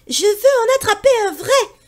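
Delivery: put-on high, loud boyish insistence; a boy boasting bravely; eager boy. Pierre tells the bird he wants a real wolf, high and loud.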